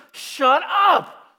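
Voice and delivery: loud, high outburst; freaking out. Retold or imitated. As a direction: snap it out in alarm. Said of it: "kinda freaks out at that moment".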